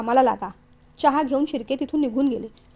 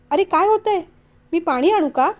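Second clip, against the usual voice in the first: high, louder worried questions; alarmed concern; 4 semitones higher, 4 dB louder than usual. Acted. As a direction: rush to help, alarmed. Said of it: "तिला धक्काच बसला".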